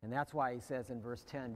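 usual speaking voice

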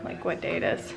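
ordinary talk, nothing marked

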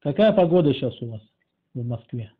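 ordinary delivery

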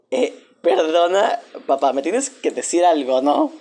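agitada. Acted. La hija exige explicación al padre.

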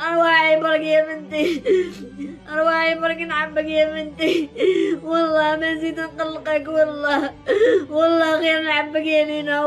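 desperate mother crying and pleading; wailing sobs, begging her daughter home; sobbing bitterly. Real, not acted. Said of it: "أم جزائرية تبكي بحرقة". (بكاء بحرقة وتوسل)

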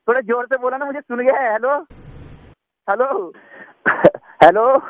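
panicked caller shouting hello at a dead line; frantic hellos. Acted. Caller begs after line goes quiet.

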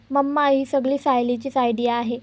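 ordinary delivery, nothing marked

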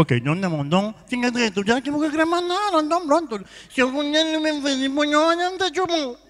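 nasal voice rising high; comic honking chef; nasal speech impediment. Acted. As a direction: argue through the nose, pitch climbing. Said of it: "me fanhoso também".

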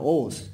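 ovos with a softened V; low, falling ovos, V nearly dropped; Colombian-Spanish ovos. Shown as a demonstration. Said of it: "como diríamos en español colombiano".

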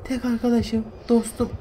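mock lament. Mock lament for lost teddy.

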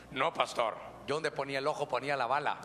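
put-on low, cocky brag of an old man; boastful churchgoer. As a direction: play the proud husband bragging. Voicing a boastful church member.

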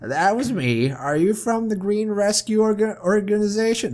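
lightly voiced woman asking; game woman, inquiring. Tiger owner asking about the rescue organization, character voice.

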